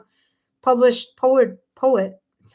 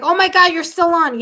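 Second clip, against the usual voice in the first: higher, louder quoted exclamation; acted surprise; 6 semitones higher, 6 dB louder than usual. Reader voices a viewer surprised she's still live, acted surprise. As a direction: imitate an amazed friend's outburst.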